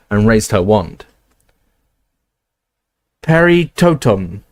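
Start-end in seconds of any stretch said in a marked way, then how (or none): 3.2-4.4 slow, deliberate chanted spell; spell incantation. Acted. McGonagall casts a spell down the corridor, slow. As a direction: intone it slowly like a charm.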